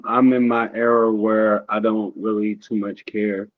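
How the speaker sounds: ordinary talk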